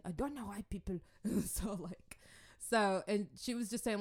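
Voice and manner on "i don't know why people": imitating mom's French African accent. Daughter imitating her ranting Congolese mom, French African accent. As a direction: put on a little French-accented African English. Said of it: "with her African accent, her little French African accent".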